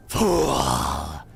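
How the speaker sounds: very low, loud, hollow inhuman voice; slow, booming pronouncement from the depths; deep unearthly voice. Acted. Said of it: "Shall I say that the voice was deep".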